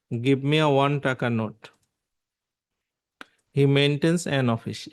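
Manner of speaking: slow, deliberate sentences; slow example read. Shown as a demonstration. Teacher dictating article examples slowly to students. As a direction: read each word apart for the class.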